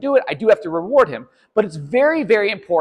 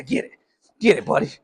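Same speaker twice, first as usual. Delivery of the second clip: ordinary talk, nothing marked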